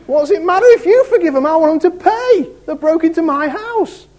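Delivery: high, loud, fast mock outrage; hammed-up furious brother wanting revenge; imitating angry brother. Brother at court scolding the pastor, high mock fury. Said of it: "He'd have said".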